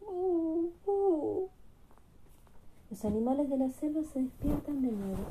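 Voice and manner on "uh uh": high whimpering sobs, growing louder; acted baby whimper. Baby elephant wakes the jungle, high mock whimpering.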